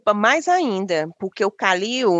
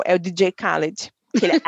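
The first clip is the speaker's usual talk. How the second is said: usual speaking voice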